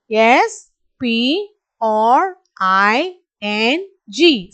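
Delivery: letters spaced out one by one; slow letter spelling. Shown as a demonstration. Teacher spelling slowly for the class to copy. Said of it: "be thorough with the spelling".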